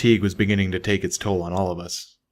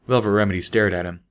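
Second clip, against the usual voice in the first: plain reading voice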